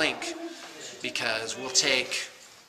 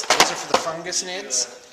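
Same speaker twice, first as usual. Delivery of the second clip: ordinary delivery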